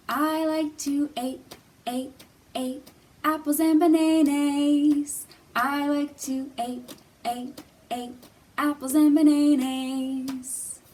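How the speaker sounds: "eat" sung as "ate", vowels turned to long A; vowels swapped to A. Shown as a demonstration. Teacher sings the A-sound verse for kids. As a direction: sing every vowel as A. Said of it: "replacing the vowel sounds with the A sound".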